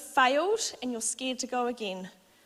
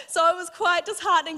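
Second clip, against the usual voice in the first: louder, higher laugh with a catch of crying; real laughter mixed with emotion; laughing through tears; 8 semitones higher, 8 dB louder than usual. Real, not acted. Laughing while recalling the pain.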